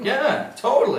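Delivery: mock-eager yes to the bouncer; eager bluff. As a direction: sell it with a bright fake confidence.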